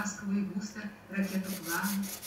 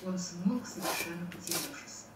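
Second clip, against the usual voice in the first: usual speaking voice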